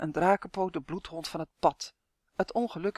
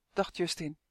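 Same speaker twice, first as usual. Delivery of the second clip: plain reading voice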